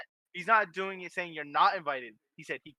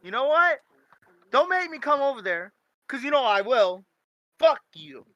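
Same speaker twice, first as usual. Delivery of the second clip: loud, high joking threat; mock angry threat; 7 semitones higher, 8 dB louder than usual. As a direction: bark it like a fake tough guy.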